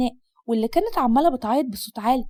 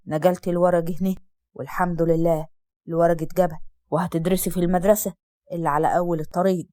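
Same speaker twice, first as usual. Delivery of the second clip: much lower, gruff-sounding father voice; father answering his daughter in a deep voice; stern father; 7 semitones lower than usual. Acted. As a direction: drop low and heavy as the strict father.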